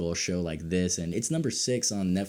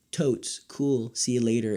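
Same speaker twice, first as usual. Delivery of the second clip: mock American slang; 4 semitones higher than usual. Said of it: "all of the dialogue is like".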